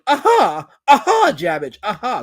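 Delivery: loud, high gloating burst; crowing aha. Real, not acted. Streamer pouncing on a heckler's message, crowing.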